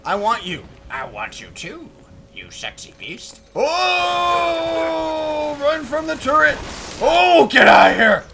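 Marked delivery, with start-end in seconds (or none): 0.9-3.4 hushed sultry come-on; mock seductive. Mock flirting with an upgrade. 3.5-8.2 high, loud cry of alarm; playful high-pitched panic; panicked shout. Pretend. Fleeing a turret in game.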